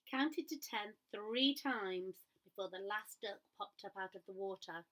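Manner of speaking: usual speaking voice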